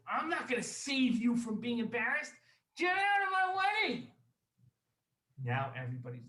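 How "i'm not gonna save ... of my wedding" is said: high, angry shout; very loud. Acted. Wealthy host throws his enemy out in front of everyone, shouting loudly. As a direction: build to a full acted yell. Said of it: "he says very loudly".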